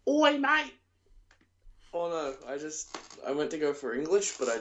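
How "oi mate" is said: high, loud mock British "Oi, mate!"; a caricatured British accent, pitched way up; mock Cockney accent. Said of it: "went higher on my accent".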